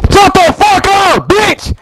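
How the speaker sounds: real screeching high-pitched fury; angry yell. Real, not acted. Player cursing back at a rival mid-quarrel, angry yell. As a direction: stretch each word out, high and furious.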